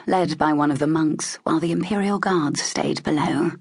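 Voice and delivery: plain reading voice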